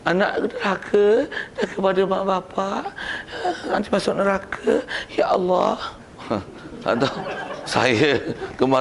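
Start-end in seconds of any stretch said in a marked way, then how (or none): 0.1-5.8 high-pitched, overwrought preacher wail; mock a melodramatic sermon, pitched way up; Siti Nurbahiyah. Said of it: "janganlah mengada-ngada macam apa, Siti Nurbahiyah tu".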